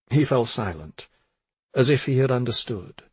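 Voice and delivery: ordinary delivery, nothing marked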